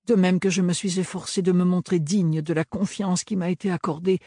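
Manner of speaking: formal, careful, earnest plea; earnest young apprentice. A narrator's reading. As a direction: defend yourself politely but firmly. Ophélie's formal speech. (jeune apprentie sérieuse)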